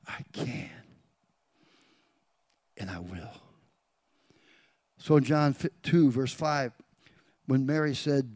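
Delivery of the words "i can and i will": low, hushed whisper. Acted. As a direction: whisper it with a long pause between promises. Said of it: "He's whispering".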